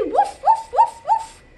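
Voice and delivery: high yippy little woofs; playful puppy bark; dog barking. Pretend. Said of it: "They say".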